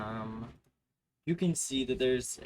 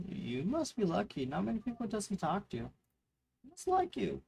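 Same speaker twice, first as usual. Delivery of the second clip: impressed fellow guard; 7 semitones higher than usual. Acted.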